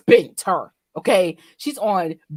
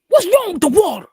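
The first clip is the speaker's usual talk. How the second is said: higher, louder mock mom; 9 semitones higher, 10 dB louder than usual. Telling a story, mom's alarmed question in a higher, louder voice. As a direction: mom demanding answers about the water.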